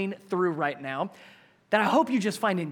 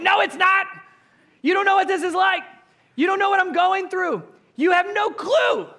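much higher and louder, upset outburst; exasperated retort; 12 semitones higher, 7 dB louder than usual. Retold or imitated. A panicked person snapping back at hollow comfort, upset. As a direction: fire it back at the empty comforter.

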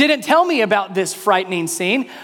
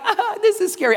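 mild mock scare; 10 semitones higher than usual. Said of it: "I don't mean like".

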